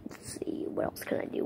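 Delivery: unvoiced whisper to himself. Shown as a demonstration. Thinking aloud which voice to do, whispered. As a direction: whisper it under your breath.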